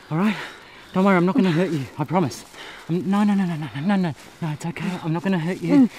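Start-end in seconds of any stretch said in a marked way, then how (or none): none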